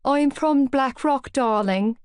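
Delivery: teasing fake Southside Dublin poshness, slow and lofty; posh Southside Dublin accent. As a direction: put on your poshest Southside Dublin accent. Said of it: "I put on my poshest Southside accent".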